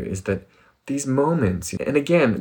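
ordinary talk

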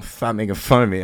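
whistle the s in "Stop" through broken teeth; Elmer Fudd. Retold or imitated. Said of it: "I'm whistling like Elmer Fudd".

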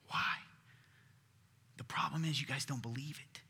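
hushed confiding whisper; soft whispered aside. Real, not acted. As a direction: lean in and whisper it. Unvoiced aside.

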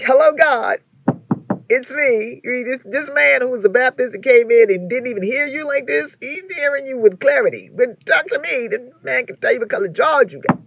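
high, loud mock complaint to God; jealous plea. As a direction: act out a jealous plea for attention.

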